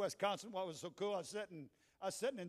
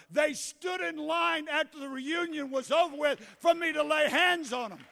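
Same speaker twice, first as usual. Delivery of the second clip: loud, high shouted sermon climax; full-force preaching; 11 semitones higher, 16 dB louder than usual. Real, not acted. Preacher urging congregation not to be ashamed, full-force preaching. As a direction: build to a sustained, slowing shout to rouse the crowd.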